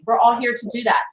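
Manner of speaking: ordinary talk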